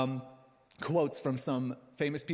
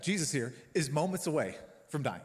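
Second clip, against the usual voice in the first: ordinary delivery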